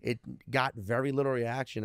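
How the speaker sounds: usual speaking voice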